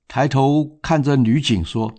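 ordinary delivery, nothing marked

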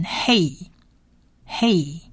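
"hey" sounded slowly, stretched; syllable demo. Shown as a demonstration. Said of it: "hate的呵加上A就变hey". (慢慢拼出hey)

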